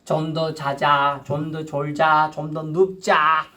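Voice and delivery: slow, drawn-out coaxing whisper of temptation; the devil coaxing a believer to stay in bed, very slow and luring; tempting devil. Acted. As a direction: lull them to sleep, dragging every word. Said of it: "그 사탄 마귀가 하는 방법이 뭡니까".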